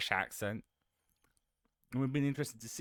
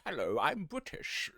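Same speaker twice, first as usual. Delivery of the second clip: posh British; 4 semitones higher, 4 dB quieter than usual. Acted. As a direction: put on a stock posh British voice. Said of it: "the generic posh".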